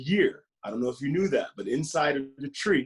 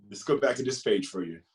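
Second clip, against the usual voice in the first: plain reading voice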